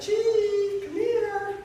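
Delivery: high, sugary call; sweetly calling. Retold or imitated. To the Chief, hiding a fake snake, a high sweet call. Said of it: "I called the Chief really nice".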